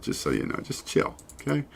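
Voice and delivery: breathy whispered aside under his breath; a real whisper, half to himself. Real, not acted. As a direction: hush it out as a side note. Muttered aside while working.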